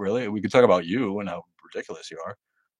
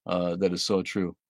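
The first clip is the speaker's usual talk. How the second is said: usual speaking voice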